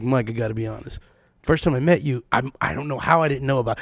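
hushed admission, lightly voiced; sheepish confession. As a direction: guest quietly owning up to not knowing him. Guest confessing, voiced lower.

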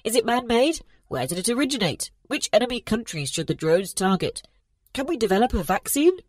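high, tinny overlapping questions; metallic voice. Acted. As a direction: fire questions through a speaker. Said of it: "The metallic voice came from the conference screen".

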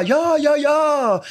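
put-on high, happy little-girl cries; delighted child. Daughter getting her shoe back, high delighted child voice. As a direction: squeal with joy.

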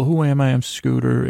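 ordinary talk, nothing marked

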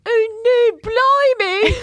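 very high, loud mock-British squeal; exaggerated British accent. Shown as a demonstration. Co-host showing off a British accent, squealed and hammed-up. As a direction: ham up the British accent, drawing out the vowels. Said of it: "I can do it".

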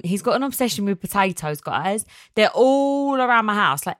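ordinary talk, nothing marked

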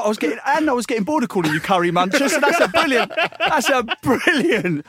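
gloating mock voice. Premier gloating over a new nickname, high put-on voice. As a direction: lord it over him, amused.

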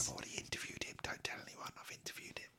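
hushed conspiratorial whisper; secretive whisper. Pretend. Host confiding a secret interview to co-host, whispered. Mock secret aside on a podcast.

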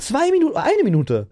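jumps way up on Zwei Minuten in disbelief; incredulous emphasis. Retold or imitated. Telling a friend how close he came, pitch leaps high in disbelief. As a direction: stress the near miss, voice leaping high. Stressed retelling of near miss.

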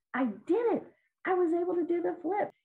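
imitating a thrilled kid who just nailed it; excited child. Girl proudly telling her coach she did the flip, excited high voice. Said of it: "And she told me".

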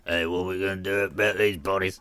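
low, quiet gruff murderer voice; a low rough killer muttering over bodies. Pretend. One murderer asks his partner about the bodies, gruff and low.

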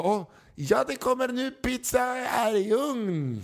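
a stressed restaurant owner promising pizza, voice way up high; new owner calming the angry father; flustered owner. Retold or imitated.

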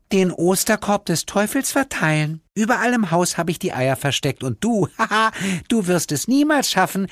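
put-on high, taunting girl voice with a laugh; a little demon girl relishing her threat; gloating hell angel. (höhnische Höllenengel-Stimme)

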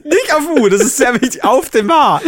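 high, loud laughing words; laughing hard. Real, not acted. Laughing fit.

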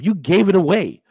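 ordinary talk

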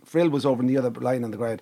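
ordinary delivery

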